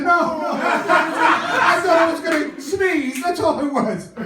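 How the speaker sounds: high, loud, drawn-out mock protest; flustered denial. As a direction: blurt out a panicked excuse. Flustered excuse in acted exchange.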